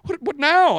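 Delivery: high, loud startled cry; flustered gasp. Retold or imitated. A woman startled as tears fall, high flustered cry. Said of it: "She's like".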